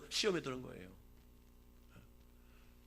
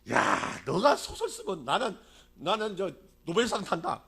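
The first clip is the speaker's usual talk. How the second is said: mocking elephant; 2 semitones higher, 8 dB louder, slower than usual. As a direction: sneer at the rabbit like a boastful elephant. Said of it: "막 조롱을 하는 거예요". (조롱하는 코끼리 말투)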